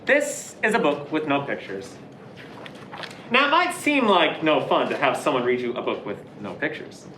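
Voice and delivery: ordinary delivery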